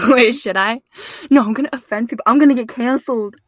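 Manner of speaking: loud, amused objection; flustered protest. Real, not acted. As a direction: protest loudly to a friend's dare. Flustered laughing protest to friend's dare.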